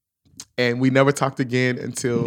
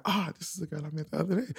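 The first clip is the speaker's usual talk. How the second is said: fast, hushed realization under his breath; quiet aside to himself; 3 semitones higher, 11 dB quieter, faster than usual. Retold or imitated. Telling the hosts how he realized who she was, a fast hushed aside. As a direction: murmur it quickly as the penny drops.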